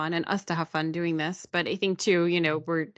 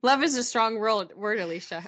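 laughing; 5 semitones higher than usual. Real, not acted. Teasing dodge, laugh heard.

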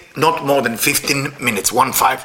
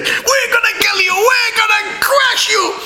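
mock angel threat; slower than usual. Angels shout threats at Moshe to guard the Torah, hammed-up roar. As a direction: bellow it like furious angels.

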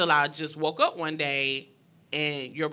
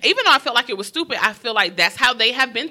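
excited; 7 semitones higher, 9 dB louder than usual. Real, not acted. Passionate point.

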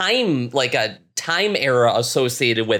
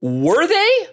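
high-pitched, disbelieving question; openly sceptical, voice shooting up high; incredulous challenge; 14 semitones higher, 4 dB louder than usual. Real, not acted. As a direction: throw the claim back, pitch leaping up in disbelief. Challenging a quoted claim in frustration.

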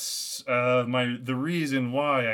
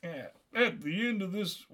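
put-on French accent; 5 semitones higher, 4 dB quieter than usual. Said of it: "in the French accent".

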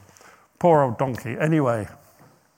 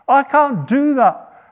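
slow, high, louder refusal; each word slow and firm; emphatic refusal; 9 semitones higher, 6 dB louder, slower than usual. Retold or imitated. Balaam refuses again.

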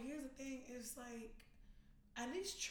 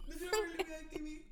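mock plea; 5 semitones higher, 4 dB louder, faster than usual. Acting out show scene.

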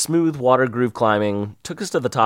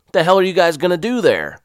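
louder, higher grilling sergeant; gruff sergeant; 8 semitones higher, 7 dB louder, faster than usual. Retold or imitated. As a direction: interrogate them like a drill sergeant. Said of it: "grilled Sergeant Tubby".

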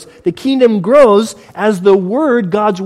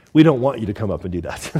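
laughing; 9 semitones lower, 4 dB quieter, faster than usual. Real, not acted. Laughing at own joke to congregation.

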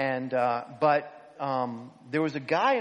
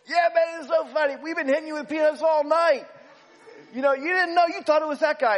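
high loud laughing shout from far away; calling down; 13 semitones higher, 7 dB louder than usual. Retold or imitated. Prankster yells down to angry fan, high shout. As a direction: shout it down gleefully. Guy shouts back from rows up.

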